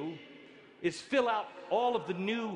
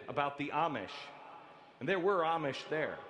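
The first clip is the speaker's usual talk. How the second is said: usual speaking voice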